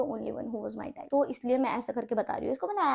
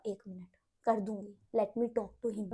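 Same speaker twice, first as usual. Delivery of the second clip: patient hush; 4 semitones lower, 6 dB quieter, slower than usual. Retold or imitated. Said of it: "मैंने उसे तीन चार बार बहुत पेशेंटली बोला".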